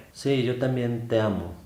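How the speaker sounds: flat i love you. Retold or imitated. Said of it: "nos digan".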